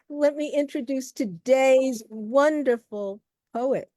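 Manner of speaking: ordinary talk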